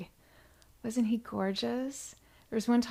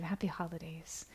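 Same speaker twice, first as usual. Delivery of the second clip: whisper; 3 semitones lower, 11 dB quieter than usual. Real, not acted. Host thanking donors on livestream.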